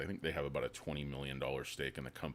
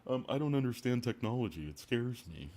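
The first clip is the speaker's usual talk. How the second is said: higher, put-on scared voice; mock timid investor; 7 semitones higher than usual. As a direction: act out a tech-phobic old guy. Continuing bear impression.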